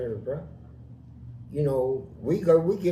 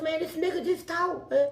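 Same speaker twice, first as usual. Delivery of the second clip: very high, amazed voice; retold astonishment; 12 semitones higher than usual. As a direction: insist on it in excited disbelief. Astonished report to cellmate.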